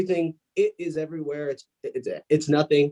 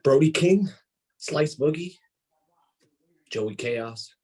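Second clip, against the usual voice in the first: menacing wrestling promo; 4 semitones lower than usual. Acted. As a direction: deliver a threat, phrase by phrase, dying away to a low close.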